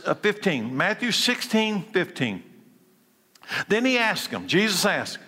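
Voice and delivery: normal talking voice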